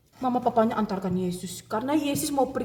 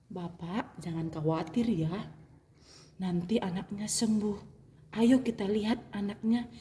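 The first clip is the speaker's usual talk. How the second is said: calm the worried father gently; reassuring Jesus; 5 dB quieter than usual. Acted.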